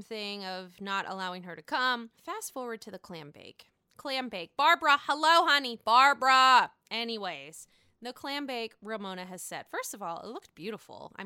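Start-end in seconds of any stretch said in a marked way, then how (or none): 4.6-6.7 loud delighted call. Real, not acted. Vlogger hails Barbara on screen, loud and delighted. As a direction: sing out her name loudly.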